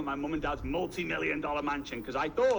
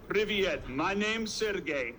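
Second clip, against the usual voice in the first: slow mock-Russian introduction; ponderous Russian-accented greeting; bad Russian accent; 2 semitones higher, slower than usual. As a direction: introduce yourself in a heavy, badly faked Russian accent. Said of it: "this is my very bad Russian accent".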